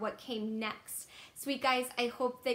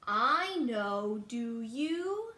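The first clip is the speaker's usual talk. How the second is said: ordinary delivery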